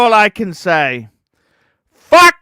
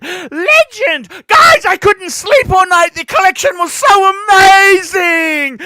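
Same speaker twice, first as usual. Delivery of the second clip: loud, higher hype shouting at the chat; excited shout; 8 semitones higher, 3 dB louder than usual. Acted. Streamer hyping donors, mock gushing shout. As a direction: whip the donors into a frenzy.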